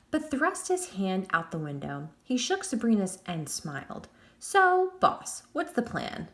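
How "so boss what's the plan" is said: put-on cocky boy voice. A narrator's reading. Jack agreeing to the deal.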